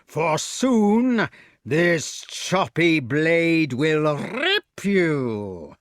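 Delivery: drawn-out gloating menace; sneering giant. A narrator's reading. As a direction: stretch out each word of the threat. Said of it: "sneered the giant".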